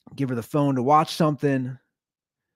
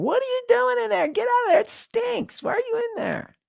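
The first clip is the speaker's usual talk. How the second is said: high-pitched mock yell from another room; wife yelling. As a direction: squeak it out like an annoyed wife calling through the wall. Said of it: "Brittany in the other room yelling".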